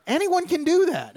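high-pitched exasperated "Anyone can do that"; heated retort. Retold or imitated. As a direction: fire it back in disbelief.

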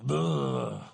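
low, drawn-out groan of dread; reluctant groan. Acted. Husband dreading an apology to his wife, low groan. Grudging reaction to apologizing to spouse.

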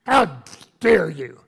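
imitating a scolding reader, loud and harsh; hammed-up telling-off; mock angry scolding. Preacher mocking readers who scold, loud mock anger. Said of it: "most people read the word rebuke there and go".

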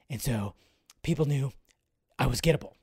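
low, hushed, raspy murmur; Joss Whedon impression. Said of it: "that Joss Whedon impression".

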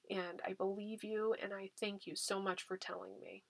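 put-on hushed, grateful parent comforting a child; tender praise. Shown as a demonstration. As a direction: hold the child gently with your voice. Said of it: "tell your child that you believe them".

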